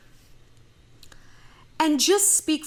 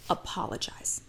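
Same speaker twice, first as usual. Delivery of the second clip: whispered apologize; 10 semitones lower, 10 dB quieter than usual. Real, not acted. Whispered advice.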